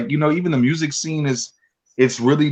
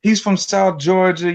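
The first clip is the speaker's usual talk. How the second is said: imitating doubters; 7 semitones higher, slower than usual. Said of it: "they're like".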